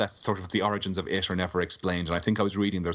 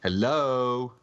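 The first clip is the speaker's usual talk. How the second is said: higher, drawn-out questioning hello; calling out; 4 semitones higher than usual. Real, not acted. Cut-off podcast guest to silent co-hosts, calling out. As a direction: call to see who's there. Checking whether the others can hear.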